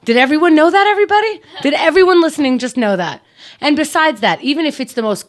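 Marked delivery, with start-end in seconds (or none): 0.0-3.2 high, loud rhetorical challenge; indignant rant. Real, not acted. Challenging the listeners on her brother's behalf, indignant. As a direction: throw it at the whole room, pitched up.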